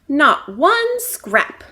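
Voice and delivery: slow, loud, drawn-out grumble; complaining. Acted. Stumpy grumbling to Roxy about no food, slow complaint. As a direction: stretch out the disappointment. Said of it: "complained Stumpy".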